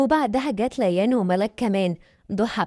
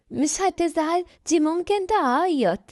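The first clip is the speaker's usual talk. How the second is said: put-on high, worried little girl; slowing into a drawn-out, fearful high voice; 7 semitones higher, slower than usual. A narrator's reading. Youngest sister worries the woman will cry, fearful. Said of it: "ردت ليان بخوف".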